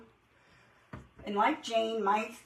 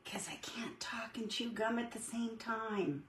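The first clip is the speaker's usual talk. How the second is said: unvoiced whispered aside; whispering; 8 dB quieter than usual. Real, not acted. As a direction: drop to a whisper, joking. Joking aside about multitasking.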